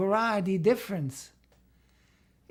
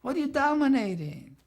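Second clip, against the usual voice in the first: higher, pressing teacher's challenge; challenging question; 6 semitones higher, 3 dB louder than usual. Retold or imitated. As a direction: push the student for an answer. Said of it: "I said".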